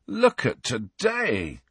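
slow, groaning complaint. Acted. Ron groaning over his timetable, slow and weary. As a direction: drag the words out like a groan. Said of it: "groaned Ron".

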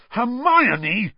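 high, loud exasperated call of her name; furious. Acted. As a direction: snap her name in outrage. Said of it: "said Ron furiously".

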